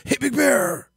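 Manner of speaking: high, out-of-breath Big Bear voice; Big Bear impression. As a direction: puff through it like you're out of air. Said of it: "You have to sound like you're running out of breath".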